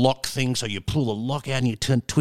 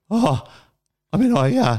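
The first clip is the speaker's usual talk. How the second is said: flustered stammer; 10 semitones higher, 4 dB louder than usual. Retold or imitated. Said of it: "And I went".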